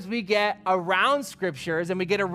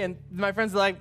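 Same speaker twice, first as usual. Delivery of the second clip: ordinary talk, nothing marked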